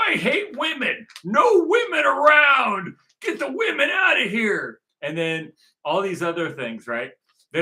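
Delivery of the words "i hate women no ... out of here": high, loud mocking shout; mock Nick Fuentes rant. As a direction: ham up a ranting extremist, much higher and louder. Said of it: "Nick Fuentes".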